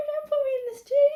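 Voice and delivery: high, fast, panicked bug voice; mock scream. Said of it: "He's screaming".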